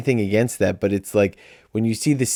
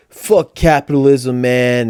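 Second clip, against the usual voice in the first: louder, slow mocking activist voice; mock activist girl; 3 semitones higher, 6 dB louder, slower than usual. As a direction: sneer it like a preachy activist. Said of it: "her whole character is like".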